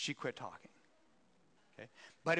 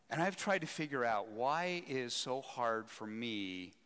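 ordinary talk

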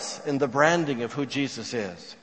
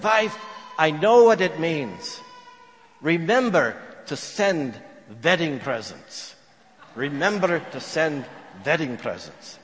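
put-on thick Eastern European accent. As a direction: announce it proudly in a heavy Eastern European accent. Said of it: "in his thick Eastern European accent".

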